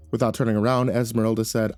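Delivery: plain reading voice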